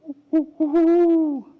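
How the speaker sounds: high, loud owl hooting; high imitation owl call. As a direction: hoot it like a storybook owl.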